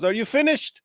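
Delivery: high, pointed mock question; mock impatient question. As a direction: ask it like a fed-up debater. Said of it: "He goes".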